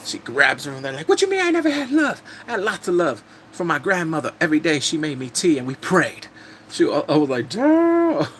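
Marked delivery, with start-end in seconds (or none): 1.1-6.3 high-pitched, indignant outburst, acted out; indignant retort. Woman in a hair-pulling fight defends her grandmother's love, mock indignant. 7.5-8.3 loud, high-pitched drawn-out exclamation; shocked reaction. Retold or imitated. Viewer reacting to a catfight on screen, shocked and delighted.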